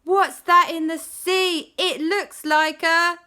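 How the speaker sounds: loud, high, slow alarmed cry; frightened cry. Acted. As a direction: gasp it out in terror. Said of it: "They said".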